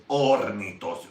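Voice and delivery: "Hornitos" with a long, exaggerated R and no H; overdone R. Correcting how some people say "Hornitos", R overdone. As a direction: drag out the R in "Hornitos". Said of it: "Why do you put three R's".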